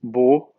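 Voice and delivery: bo as a firm, low "boh"; closed O. Shown as a demonstration. Teacher splitting a word into sounds, bo with closed O. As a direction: round the vowel in bo. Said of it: "o O fechado".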